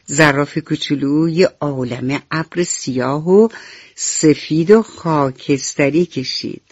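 usual speaking voice

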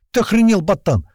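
high, loud indignant outburst; forced-out outrage. A narrator's reading. Shocked redhead on the floor to Gera, outraged. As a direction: squeeze it out, outraged. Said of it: "выдавил из себя Рыжий".

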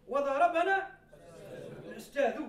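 وضربنا punched loud, الأستاذ rising; emphatic example. Shown as a demonstration. Teacher giving the example sentence, loud stress. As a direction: stress وضربنا strongly, lift الأستاذ at the end. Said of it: "هكذا".

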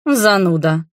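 petulant jab; offended grumble. A narrator's reading. Prince to the count who introduced him, offended grumble. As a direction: throw it out sulkily. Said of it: "обиженно буркнул он".

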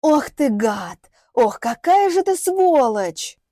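drawn-out indignation, rising high and loud; outraged cursing. Acted. Insulted woman curses her attacker, drawn-out outrage. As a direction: build from disgust to a slow wail of outrage.

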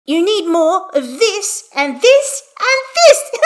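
put-on emphatic child. A narrator's reading. Hestia shows her dad what is missing, high and emphatic. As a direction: build to a loud squeal of demonstration. Child demonstrates poses, declaring.

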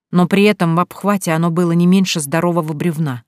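ordinary delivery, nothing marked

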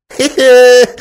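high, loud mocking snicker; a sly monk cackling; monk's chuckle. A narrator's reading. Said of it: "раздался смешок монаха".

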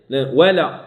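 ordinary talk, nothing marked

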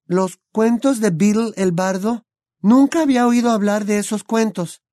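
put-on high, bright young witch voice; Hermione's voice. A narrator's reading. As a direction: voice the clever girl friend.

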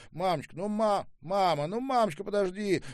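high, loud, exasperated pleading. A narrator's reading. Son to his overwhelmed mother, urgently pleading.

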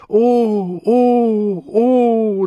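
high, loud wailed "oh"; three full-voice cries of rapture; inarticulate ecstatic cries. A narrator's reading. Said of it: "legte unartikuliert Zeugnis ab".

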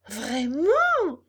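high, loud burst of delight; overjoyed cry. A narrator's reading. Puppet hears his papa is coming, overjoyed cry. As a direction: cry out as if jumping for joy. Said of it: "cria Pinocchio en sautant de joie".

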